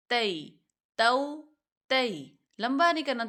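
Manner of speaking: normal talking voice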